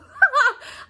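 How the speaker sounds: high, loud burst of laughter; real laugh. Real, not acted. Laughs at her own uncertainty.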